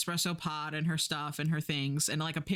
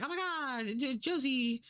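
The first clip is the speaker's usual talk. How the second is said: higher, breathless news alarm; higher mock newscaster panic; frantic news; 9 semitones higher than usual. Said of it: "all the news is like".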